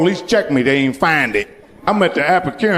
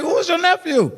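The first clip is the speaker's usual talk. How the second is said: nervous question; 11 semitones higher than usual. Retold or imitated. Panicked question to the man.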